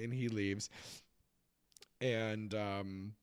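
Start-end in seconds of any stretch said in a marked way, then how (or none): none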